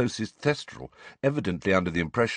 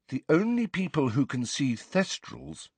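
lightly voiced pupil answering, higher; schoolgirl answer; 7 semitones higher than usual. A narrator's reading. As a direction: give the answer in a higher girl's voice. Hermione answers in class.

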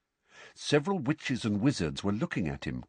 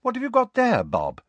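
curious question; 4 dB louder than usual. Acted. Mr Weasley asking Bob.

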